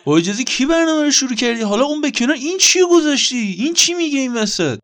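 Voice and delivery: furious host scolding his inner voice; angry outburst. Acted. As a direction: storm in and demand who started.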